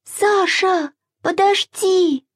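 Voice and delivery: put-on high, breathless little-girl cry after her brother; breathless call. A narrator's reading. Masha, out of breath, calls to Sasha to wait. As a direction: call out high and panting, trying to catch up. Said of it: "Она запыхалась и крикнула".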